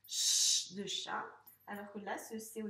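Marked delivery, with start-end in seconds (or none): 0.1-0.7 long, loud voiceless "ch", like shhh; isolated ch sound. Shown as a demonstration. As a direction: hold "ch" strongly, with no vowel. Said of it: "fait le son". (le son « ch » isolé, fort et prolongé)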